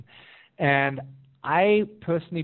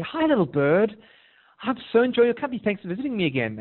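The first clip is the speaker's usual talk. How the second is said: lightly acted delight, pitched up; delighted greeting; 8 semitones higher, 3 dB louder than usual. Person welcoming the returning little bird, lightly acted delighted greeting. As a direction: greet a little visitor with a touch of glee.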